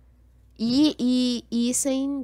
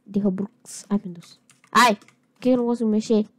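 ordinary talk, nothing marked

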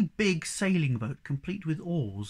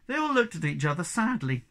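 usual speaking voice

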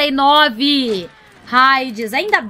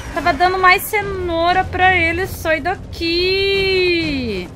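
usual speaking voice

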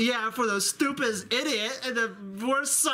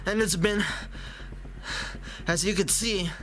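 much lower, tired dad voice; low weary father; 6 semitones lower than usual. Acted. Tired father complaining about his son, low weary voice. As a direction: sag under the exhaustion.

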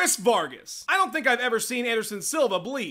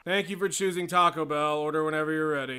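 bored cashier; 7 semitones lower than usual. Acted. Taco Bell cashier.

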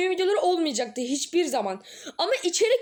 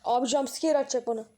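normal talking voice

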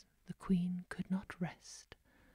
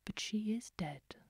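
plain reading voice

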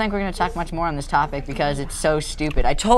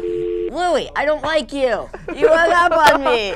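high-pitched, laughing mock grievance; mock complaint; 11 semitones higher, 4 dB louder, slower than usual. Host scolding a caller who hung up, mock complaint. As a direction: whine playfully, loud on the last words.

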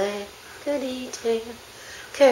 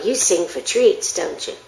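ordinary talk, nothing marked

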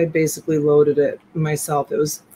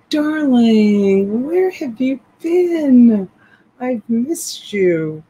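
high, slow swooning greeting; mock dramatic diva; 7 semitones higher, 5 dB louder, much slower than usual. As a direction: gush like a melodramatic lover.

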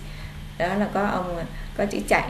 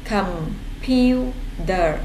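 "Computer" drawn out very slow; read slowly; much slower than usual. Shown as a demonstration. Teacher slowing down so pupils can follow. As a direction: stretch "Computer" out for the class. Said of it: "Cô đọc chậm nha".

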